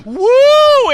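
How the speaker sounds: put-on high loud party-girl squeal; woo girl. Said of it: "a woo girl who is often found going".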